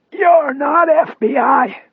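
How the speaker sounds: slow, high strained rasp; gallant rasp. A narrator's reading. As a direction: stand up to them in a raspy voice. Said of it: "he said gallantly".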